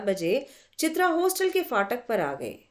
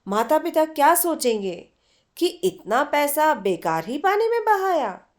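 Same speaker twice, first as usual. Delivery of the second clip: raised, higher scolding voice; scolding friend; 5 semitones higher, 4 dB louder than usual. A narrator's reading. Chitra scolds Aruna for roaming instead of studying, raised voice. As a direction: chide her like a worried friend.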